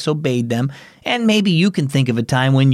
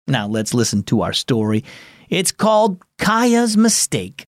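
usual speaking voice

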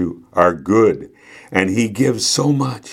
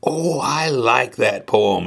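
slow, higher-pitched grandmotherly delight; delighted exclamation; 4 semitones higher, slower than usual. A narrator's reading. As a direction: exclaim it slowly, savoring the poem. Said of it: "exclaimed Grandma".

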